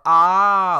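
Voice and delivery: very loud, high, sustained "ah"; mock scream. Host showing how characters scream in the book, loud mock scream. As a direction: hold out a big imitation scream. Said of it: "like, screaming".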